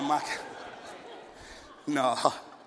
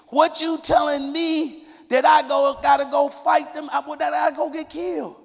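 Gideon's loud protest; 8 semitones higher, 5 dB louder than usual. Acted.

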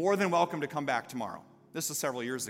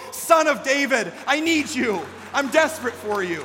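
loud, high desperate plea; a blind beggar begging by the roadside; Bartimaeus crying out; 9 semitones higher, 11 dB louder than usual. Acted. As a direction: cry out to the Son of David.

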